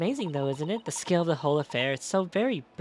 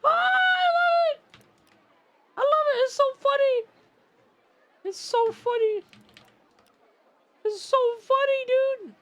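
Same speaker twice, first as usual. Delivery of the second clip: shrill, much higher, louder mock scream; mock screaming dog; 6 dB louder than usual. Streamer mimics the Dark Souls dog vine, shrill mock screaming.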